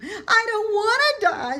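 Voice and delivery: very high, loud despairing shriek; scream. A narrator's reading. Wilbur screams again, throwing himself down. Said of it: "screamed Wilbur".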